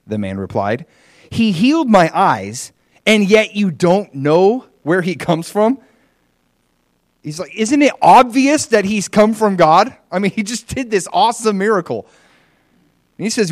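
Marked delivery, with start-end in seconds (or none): 1.3-5.8 high, loud, slow disbelief; incredulous. A narrator's reading. Healed man challenging the Pharisees, incredulous. 7.5-12.1 high-pitched, emphatic disbelief; incredulous insistence. Retold or imitated. Healed man to the Pharisees, incredulous.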